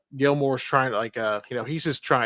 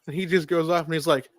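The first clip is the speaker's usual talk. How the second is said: normal talking voice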